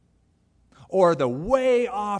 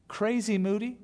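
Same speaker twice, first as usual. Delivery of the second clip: usual speaking voice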